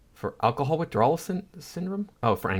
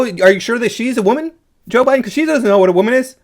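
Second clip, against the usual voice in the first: shrill, loud, much higher fake gasping delight; sarcastic mock excitement; 11 semitones higher, 8 dB louder than usual. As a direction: gush over it in sarcastic mock joy. Mocking Biden's Supreme Court boast.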